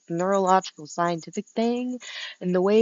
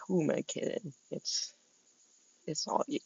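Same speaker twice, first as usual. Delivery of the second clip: soft; 9 dB quieter than usual. Retold or imitated. Retold quote, trailing off softly.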